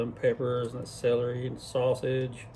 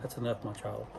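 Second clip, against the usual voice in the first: whispering ancestors; 4 semitones lower, 9 dB quieter than usual. Pretend. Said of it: "you hear the ancestors whisper".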